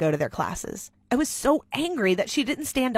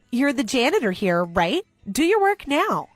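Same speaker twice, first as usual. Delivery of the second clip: bossy order; 3 semitones higher, 3 dB louder than usual. Acted. Rich girl bossing the janitor girl, snappy. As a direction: order her like a servant.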